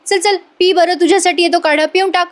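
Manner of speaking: acted-out pushy encouragement; insistent coaxing. [name] pressing Priya to drink.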